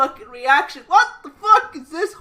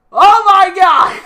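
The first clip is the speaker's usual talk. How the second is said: laughing shout; 7 semitones higher, 11 dB louder, slower than usual. Real, not acted. Shocked at awful game graphics.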